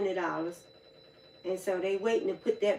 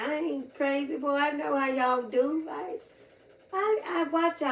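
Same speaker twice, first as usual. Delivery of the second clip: higher-pitched, sassy retelling; mock scolding, high and knowing; knowing tease; 6 semitones higher than usual. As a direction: play the know-it-all auntie, pitch up. Retold telling off the homeless man.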